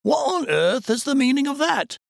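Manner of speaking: very high, astonished character voice; exclaimed in surprise. A narrator's reading. First man questioning his companion about the tower light, exclaiming. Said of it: "exclaimed the first man".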